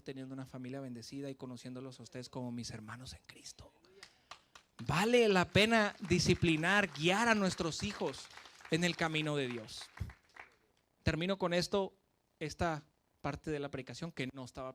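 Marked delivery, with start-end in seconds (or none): 4.9-9.9 slow and much louder, each word weighted; fervent preaching. Real, not acted. Preacher urging parents, slow and loud. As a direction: proclaim it with full conviction.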